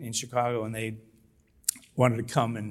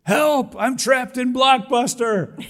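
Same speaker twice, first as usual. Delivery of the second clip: much higher, louder slow yell; loud scream; 13 semitones higher, 9 dB louder, slower than usual. Retold or imitated. Stuck in a long Blockbuster line, a loud high mock scream for help. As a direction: yell for help as a joke to the room. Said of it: "I just screamed as loud as I could".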